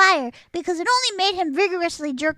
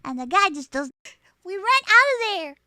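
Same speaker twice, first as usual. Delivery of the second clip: ordinary talk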